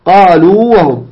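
قالوا drawn out slowly on a long uu, then وهم; slow recited قالوا وهم, no merging; lengthened waw. Shown as a demonstration. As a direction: stretch the final uu of قالوا before a separate wa. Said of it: "إذن نمدها، نقول".

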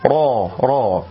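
"را" said thick and dark, like "ro"; heavy ra. Shown as a demonstration. Teacher isolates the letter for learners, ra made heavy. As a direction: round out each "را" with a full back vowel. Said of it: "بتفخيم الراء".

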